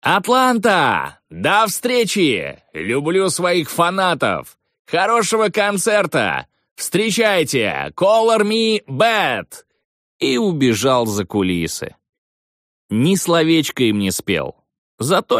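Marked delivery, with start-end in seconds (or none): 0.0-9.6 high, slow drawn-out arena call; shouting announcer. Retold or imitated. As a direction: stretch it like a stadium announcer. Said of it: "я прокричал".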